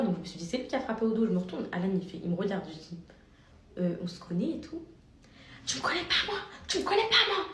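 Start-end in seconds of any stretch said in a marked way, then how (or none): 5.7-7.4 high-pitched imitation of an angry stranger; mocking the woman who stopped her in the street; high mock aggressive stranger. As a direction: squeak out the stranger's challenge.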